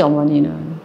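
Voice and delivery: ordinary delivery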